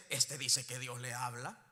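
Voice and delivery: low-pitched derisive quote; imitating mockers. Mocker talks about a believer who hears God, scoffing. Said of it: "hasta se burla y dice".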